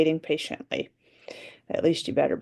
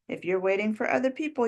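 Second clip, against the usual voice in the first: ordinary talk, nothing marked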